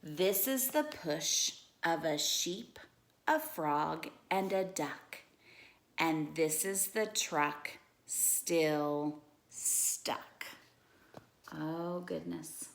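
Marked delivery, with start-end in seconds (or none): 0.0-10.5 lively storybook read-aloud; animated bedtime-story reading; reading to children. Acted. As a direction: read it like a picture book for kids.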